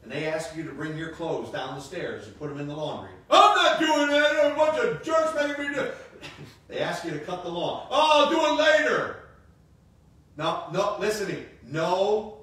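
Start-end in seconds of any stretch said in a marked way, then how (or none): 3.3-6.0 loud, high mock teenage grumble; whiny teenager. Mocking a sulky kid. 7.9-9.2 loud, high mock teenage grumble; whiny teenager. Mocking a sulky kid.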